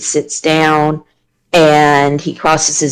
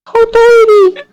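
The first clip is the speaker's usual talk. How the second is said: cooing; 4 dB louder, much slower than usual. Real, not acted. Cooing over the crying kid.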